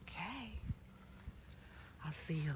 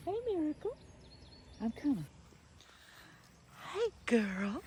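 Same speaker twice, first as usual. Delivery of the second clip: very high cooing hello; pet greeting; 9 semitones higher than usual. Real, not acted. Owner greeting her rabbit Miracle, very high coo.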